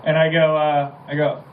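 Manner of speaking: normal talking voice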